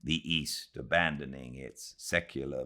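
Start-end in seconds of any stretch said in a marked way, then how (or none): none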